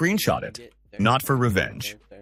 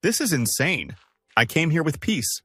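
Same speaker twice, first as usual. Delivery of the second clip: raised voice; 6 semitones higher than usual. A narrator's reading. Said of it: "Her voice rose".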